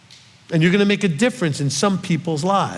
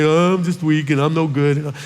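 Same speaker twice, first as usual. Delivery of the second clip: lightly put-on complaining whine; mock whining; 2 semitones lower than usual. Preacher imitating someone crumpled up in a ball, mock whine. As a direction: whine it in mock self-pity. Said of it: "just whine and complain and say".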